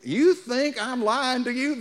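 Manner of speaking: ordinary delivery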